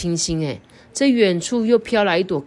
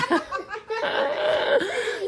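high giggle. Real, not acted. Laughing after goat bleat.

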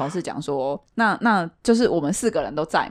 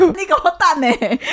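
high, slow, drawn-out cry to wait; imitating a friend panicking from the shower; mock panicked plea; 9 semitones higher, 3 dB louder, slower than usual. Friend in the shower begging her to wait, high and drawn out.

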